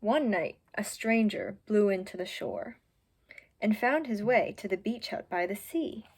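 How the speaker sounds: usual speaking voice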